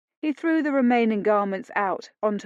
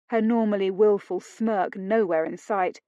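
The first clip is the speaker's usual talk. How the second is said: ordinary delivery, nothing marked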